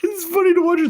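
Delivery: a cartoon squeak; squeaky put-on voice. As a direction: squeak it out high and quick. High squeaky voiced aside.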